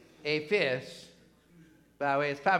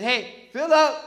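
loud, higher-pitched hail; calling out; 7 semitones higher, 11 dB louder, slower than usual. Acted. God hailing Philip to send him south, calling out. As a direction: call out to get his attention.

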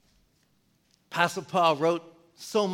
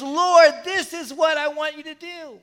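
passionate prayer; 8 semitones higher, 3 dB louder than usual. Retold or imitated. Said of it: "he is passionately going to God".

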